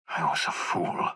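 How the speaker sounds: whispered self-reproach. Acted.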